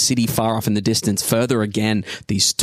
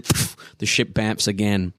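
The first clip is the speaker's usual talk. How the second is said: ordinary talk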